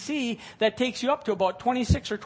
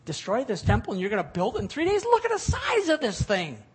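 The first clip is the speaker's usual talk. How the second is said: incredulous; 5 semitones higher, 4 dB louder than usual. Acted. Disciples staring at the huge temple, incredulous. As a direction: pitch jumping much higher in disbelief.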